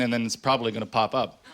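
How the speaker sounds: ordinary talk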